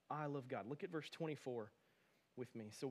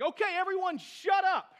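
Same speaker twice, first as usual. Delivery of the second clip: high, loud exasperated shout; snapped; 14 semitones higher, 13 dB louder than usual. Retold or imitated. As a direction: snap and yell at the whole room. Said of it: "I snapped".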